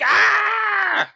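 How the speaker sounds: high loud yelp of pain; mock pained scream. Grabber falling on his ankle, a loud high mock yelp.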